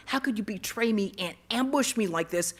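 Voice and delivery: loud, outraged tirade; retold angry scream. Betrayed boy rages at God, retold angry scream. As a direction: hurl the accusations, building to the broken-off curse. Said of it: "I screamed at him".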